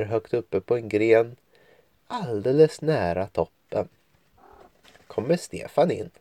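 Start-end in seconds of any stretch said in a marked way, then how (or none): none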